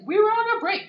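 high, loud whiny protest; whiny mock excuse. Friend mimicking Blue's excuse, high loud whine. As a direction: whine it like a caught cheater. Blue defending himself.

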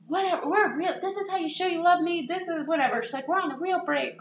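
high, fast mocking tirade; mock pissed rant. Friend imitating angry Debbie, high and fast. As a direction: rant like a scorned girlfriend, sped up. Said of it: "she's pissed".

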